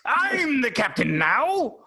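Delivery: high, slow hammed-up pirate; pirate accent. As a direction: growl it like a pirate captain. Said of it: "he's a pirate".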